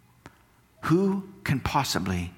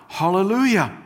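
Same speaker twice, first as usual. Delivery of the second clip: loud, higher "Hallelujah" called out; cried out hallelujah; 8 semitones higher, 6 dB louder than usual. Acted. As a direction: ring it out like a great multitude. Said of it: "they cried out".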